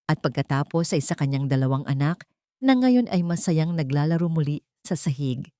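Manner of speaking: usual speaking voice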